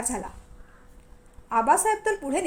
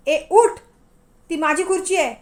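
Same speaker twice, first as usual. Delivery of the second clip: loud, furious command to get up; hot-tempered loud shout; angry order; 3 semitones higher, 7 dB louder than usual. Acted. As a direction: bark at her to get off your chair. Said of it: "तो चिडून म्हणाला".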